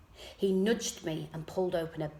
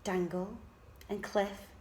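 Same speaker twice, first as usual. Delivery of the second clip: muttered; 6 dB quieter than usual. A narrator's reading. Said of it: "I muttered the words".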